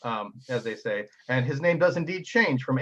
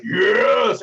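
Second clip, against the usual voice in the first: a loud cry of victory; triumphant yes; 6 semitones higher, 10 dB louder than usual. Acted. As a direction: shout it out in triumph. GM voices triumphant captain.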